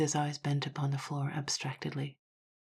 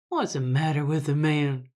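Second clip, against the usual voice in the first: louder, scornful outburst; rude exclamation; 7 dB louder than usual. A narrator's reading. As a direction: throw it out with a rude laugh behind it. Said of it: "he ejaculated".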